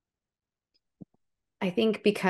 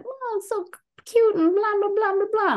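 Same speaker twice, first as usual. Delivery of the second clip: much higher, mocking baby-talk coo at a puppy; mocking cooing tourists; 4 dB louder than usual. Mocking non-vegans gushing over a puppy, high mock coo. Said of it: "I'm watching them being just like".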